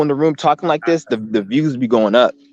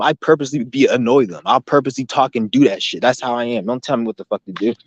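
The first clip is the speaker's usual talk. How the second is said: ordinary delivery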